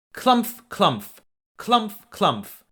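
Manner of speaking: stomp it out like big hooves; big goat's pounding steps; heavy clumph steps. A narrator's reading.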